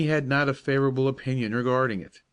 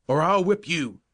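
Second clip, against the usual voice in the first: loud, higher threatening shout; angry threat; 5 semitones higher, 4 dB louder than usual. Acted. Angry captain threatening Joe on the towpath: loud shout. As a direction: threaten the boy with a roar. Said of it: "he cried".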